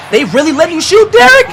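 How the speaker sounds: incredulous cry. Real, not acted. Disbelief the defense lets him shoot.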